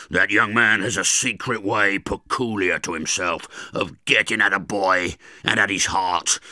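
loud, rough threatening convict voice; menacing convict. Acted. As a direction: loom over the boy and threaten him.

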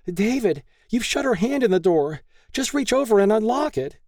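very high-pitched urgent plea; desperate pleading. A narrator's reading. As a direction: beg him urgently to open up. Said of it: "Lay pleaded".

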